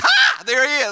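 sudden loud, high exclaim; mock delighted shout; joyful surprise. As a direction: burst out in delight at seeing him. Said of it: "you're like".

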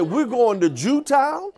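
eager, laughing announcement; great enthusiasm. Retold or imitated. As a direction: say it gladly, laughing, no idea it's wrong. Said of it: "I said it again with great enthusiasm".

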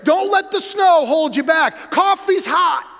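loud, high begging pastor plea; desperate pastor. Retold or imitated. Mocking a pastor begging his church to come. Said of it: "so desperate".

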